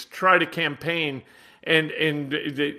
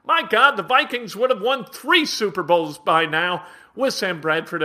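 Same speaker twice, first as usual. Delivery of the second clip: ordinary delivery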